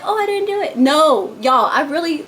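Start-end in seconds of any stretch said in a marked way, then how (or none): 0.0-0.8 high mocking voice of fake innocence; mock denier. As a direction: play someone pleading innocence in a high pitch. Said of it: "those people that be like".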